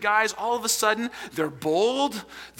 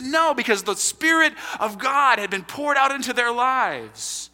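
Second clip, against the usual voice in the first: preaching shout; 6 semitones higher than usual. Real, not acted. Pastor proclaims the answer to the congregation, shouting. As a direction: drive the answer home at full voice.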